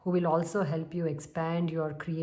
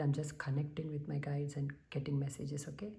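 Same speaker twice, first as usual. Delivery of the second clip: soft whisper; hushed explanation; whispered aside; 2 semitones lower, 7 dB quieter than usual. Real, not acted. Quietly explains pauses.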